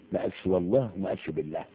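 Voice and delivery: normal talking voice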